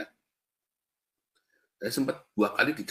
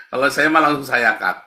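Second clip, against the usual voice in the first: loud, higher punchline after a laugh; emphatic repeat; 4 semitones higher, 10 dB louder than usual. Real, not acted. Preacher repeats the punchline to the congregation, loud and emphatic. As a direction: hammer it home loudly, pitched up.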